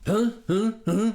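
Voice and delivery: sharp barking huhs; barked. Acted. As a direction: bark each huh like a dog. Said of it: "the hairy visitor barked and coughed".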